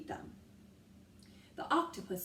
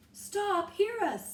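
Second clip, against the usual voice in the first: loud, high plea; urgent call; 11 semitones higher, 6 dB louder, slower than usual. A narrator's reading. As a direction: call out to the crew. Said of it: "said Annie".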